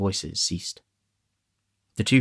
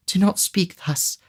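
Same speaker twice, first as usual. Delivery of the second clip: mild tearful plea; 12 semitones higher, 5 dB louder, slower than usual. A narrator's reading.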